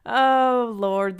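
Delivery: loud, high, drawn-out groan of dismay; exasperated outburst. Real, not acted. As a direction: heave out a big exasperated cry. Exasperated at Supreme Court decisions.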